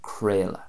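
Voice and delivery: "creala" as KRAY-la; Munster pronunciation. Shown as a demonstration. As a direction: say "creala" with an A sound in middle and end. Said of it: "an A sound in the middle".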